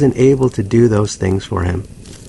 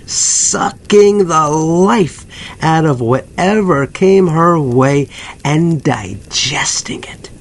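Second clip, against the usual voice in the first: slow, creeping, half-whispered menace; sinister hush; 4 semitones higher, slower than usual. Acted. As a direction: draw it out like something feeding. Dark description of a predator.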